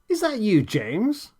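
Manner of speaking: tinny faraway walkie-talkie voice; thin distant radio voice. A narrator's reading. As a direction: sound far off through a crackly speaker. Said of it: "George's thin and distant reply through the radio's speaker".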